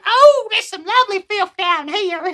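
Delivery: put-on high, loud old woman's voice; old lady. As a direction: squeal like a delighted old crone. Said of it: "here comes the old lady".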